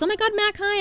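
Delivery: high squealed greeting; high, thrilled hello; excited greeting. Retold or imitated. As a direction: greet her like an old friend. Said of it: "everyone goes".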